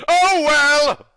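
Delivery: loud, high "oh, well!" thrown up in disbelief; a big loud sigh of disbelief; exasperated outburst. Real, not acted. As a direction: burst out in mock exasperation. Reader reacts to the silly line.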